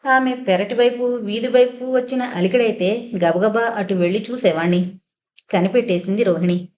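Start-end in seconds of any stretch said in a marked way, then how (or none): none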